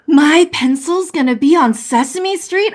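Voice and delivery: put-on high, thrilled child's disbelief; delighted daughter asking in wonder; amazed child. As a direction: squeal it like an awestruck kid.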